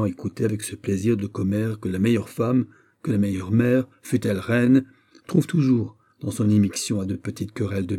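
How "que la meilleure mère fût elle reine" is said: ordinary delivery, nothing marked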